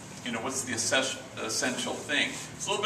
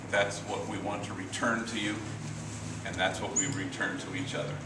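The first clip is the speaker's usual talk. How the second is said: tender close; 4 semitones lower, 9 dB quieter than usual. Real, not acted. Speaker closing to the audience, softer and tender. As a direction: tell them gently they are splendid.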